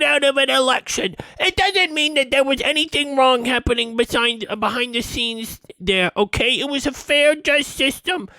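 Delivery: high, sneering mock voice of the doubters; mocking people who dismissed the rigging; mocking quote. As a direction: parrot them in a whiny high voice. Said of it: "They were like".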